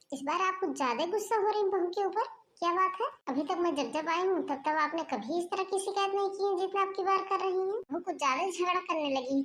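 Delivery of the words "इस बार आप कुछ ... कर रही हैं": lightly voiced sister-in-law, higher, curious; a hint of a nosy relative asking what's wrong.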